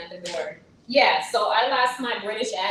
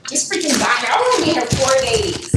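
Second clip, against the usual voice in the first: raised-voice exasperated protest; 5 semitones higher, 8 dB louder than usual. Real, not acted. Streamer defending a vacation from cooking, loudly exasperated. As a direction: protest loudly, pitch pushed up.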